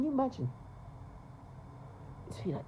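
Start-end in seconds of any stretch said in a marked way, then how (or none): none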